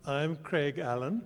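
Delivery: ordinary talk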